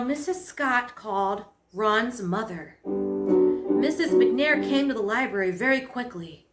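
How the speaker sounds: ordinary delivery, nothing marked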